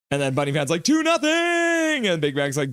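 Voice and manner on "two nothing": high, stretched-out cheer; celebratory fan yell. Acted. Streamer reading a fan's chat after a goal, celebratory yell. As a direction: hold the last word up high.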